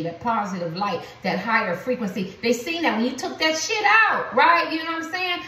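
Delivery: ordinary talk, nothing marked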